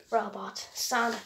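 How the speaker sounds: ordinary talk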